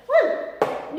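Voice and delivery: airy high little woo of excitement; breathy whoop. Real, not acted. Trainer revealing the next tip, high breathy whoop. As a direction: throw out a quick breathy woo. Hyping up a workout video.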